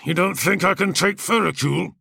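swaggering gladiator, barely hiding scorn; lightly voiced brash warrior. Kargos asking.

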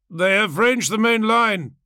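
high, loud declamation; involuntary battle report. A narrator's reading. Involuntary words repeated by warrior.

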